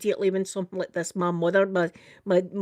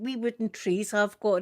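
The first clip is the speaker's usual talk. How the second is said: normal talking voice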